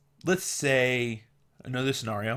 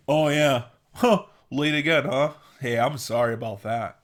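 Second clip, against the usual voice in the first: flippant mock apology; 5 dB louder than usual. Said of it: "Doesn't that sound better than".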